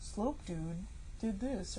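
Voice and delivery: flat slow monotone drone. Retold or imitated. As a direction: drone it out slowly, no melody. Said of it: "this weird monotone voice the whole time".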